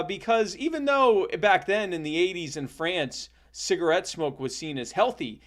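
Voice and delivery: normal talking voice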